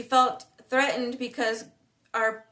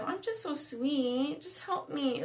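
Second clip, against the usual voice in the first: higher, softer, slow mock-sweet plea; mock her sugary helpless act, high and soft; fake-sweet voice; 4 semitones higher, 5 dB quieter, slower than usual. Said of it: "with the guise of".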